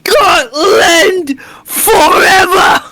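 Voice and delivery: high, slow, shouted Scottish war cry; Scottish battle cry. Acted. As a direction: bellow it like a Highland warrior. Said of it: "what does a Scottish accent sound like".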